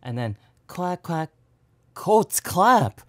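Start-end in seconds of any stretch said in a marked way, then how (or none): 2.0-3.0 sudden realization. Real, not acted. Realizing the sound is clap.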